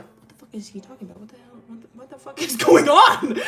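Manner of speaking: hushed fast mutter bursting into a laughing shout; building bewilderment. Retold or imitated. Ellie reacting to the stabbing talk, hushed building to a shout. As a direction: start under the breath, then explode.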